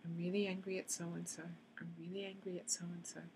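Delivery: tapping phrase fading into near silence; fading to quiet. Shown as a demonstration. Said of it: "repeats the tapping phrase as a demo".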